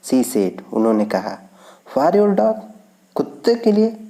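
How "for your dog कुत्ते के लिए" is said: high, surprised voice; surprised question. Acted. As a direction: ask it high in disbelief. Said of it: "asked Mrs Das". (Mrs दास का ऊँची आवाज़ में हैरानी भरा सवाल)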